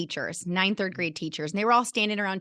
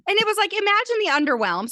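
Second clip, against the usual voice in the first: exclaiming; 13 semitones higher, 6 dB louder, faster than usual. Real, not acted. Mocking her own overreaction.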